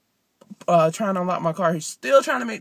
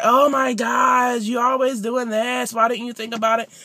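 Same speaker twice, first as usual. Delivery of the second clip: mad sister; 5 semitones higher, 4 dB louder than usual. Retold or imitated. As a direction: scold like an annoyed sister. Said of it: "she was mad".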